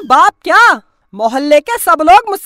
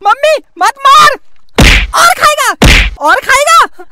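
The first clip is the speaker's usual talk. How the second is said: angry scolding; 5 semitones higher than usual. Acted. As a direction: scold while hitting him. Mother scolding while beating son. (मां का गुस्से में डांटना)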